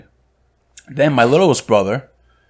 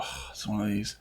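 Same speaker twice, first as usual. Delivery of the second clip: very soft, resigned mutter; muttered aside; 3 semitones lower, 16 dB quieter than usual. Retold or imitated. Customer privately sizing up a rude shop clerk, muttered aside. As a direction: say it under your breath.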